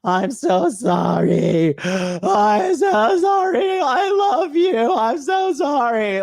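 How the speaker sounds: hammed-up sobbing plea, shouted high; mock begging and crying. Mocking a caught partner who starts begging and crying. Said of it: "they start begging and crying".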